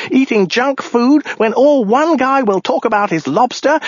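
very high, hysterical babbling rant; raving madman. Acted. Lone survivor babbling to his finders about lobsters, hysterical high rant. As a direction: rave on and on in a high, desperate pitch.

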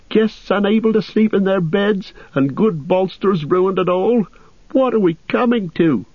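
high-pitched, scandalised innkeeper; horrified landlord cry. Acted. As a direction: lament the outrage in a high landlord voice. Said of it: "raising his hands in horror".

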